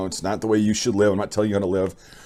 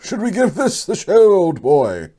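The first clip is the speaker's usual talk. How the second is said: high-pitched, hammed-up British accent; fake British accent; 10 semitones higher, 4 dB louder, slower than usual. Friend teasing after rapid fire, in a fake British accent. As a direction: put on a mock British voice, much higher than your own. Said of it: "says in a fake British accent".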